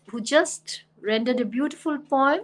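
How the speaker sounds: normal talking voice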